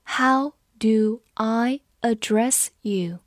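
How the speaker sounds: word by word, with gaps between words; slow read-along. Shown as a demonstration. Teacher leads students through the sentence, slow and separated. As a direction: read it slowly for learners to follow. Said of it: "跟着我慢读一遍".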